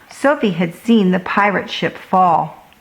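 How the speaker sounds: ordinary delivery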